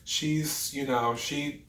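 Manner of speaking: high, gentle, breezy reassurance; sweet celebrity. Retold or imitated. Celebrity reassuring the apologizing staffer, high and breezy. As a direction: lightly voice a sweet, gracious star. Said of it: "And she's like".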